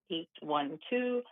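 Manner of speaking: ordinary delivery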